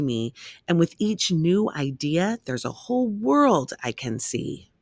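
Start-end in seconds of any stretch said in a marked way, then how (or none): none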